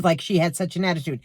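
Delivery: ordinary delivery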